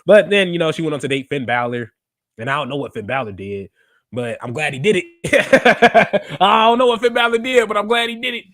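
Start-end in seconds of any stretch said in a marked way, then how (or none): 4.1-6.4 slow knowing drawl. Acted. Fan teasing the audience, slow and knowing. Repeating the joke line. 6.4-8.4 quick and higher through a laugh. Real, not acted. Fan laughing at his own joke, higher and bright.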